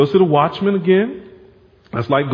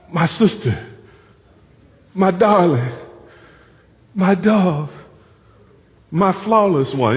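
begging husband. Acted. Husband sweet-talking his wife to let him in, mock pleading. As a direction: beg her sweetly through the door. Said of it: "and now he's all".

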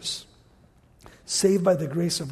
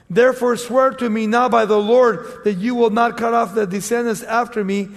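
raised, emphatic Bible reading; scripture reading; 5 dB louder than usual. Acted. Preacher reads scripture to congregation, emphatic and raised. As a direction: read the verse out to the congregation. Reads verse twenty-one.

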